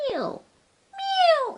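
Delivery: high mew mew; cat mewing. Acted. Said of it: "interrupted Simpkin".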